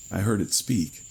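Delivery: usual speaking voice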